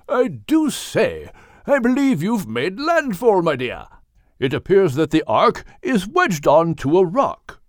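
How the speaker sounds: genteel English whale voice; proper English whale. Acted. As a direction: play Craddock reporting landfall. Craddock whale voice.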